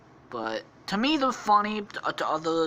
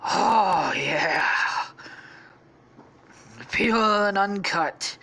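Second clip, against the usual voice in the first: louder, higher, drawn-out relish; blissed-out, long-stretched exclamation; Stampy high on coke; 6 semitones higher, 4 dB louder, much slower than usual. Acted. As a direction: savour it slowly and loudly after a big snort. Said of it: "says Stampy".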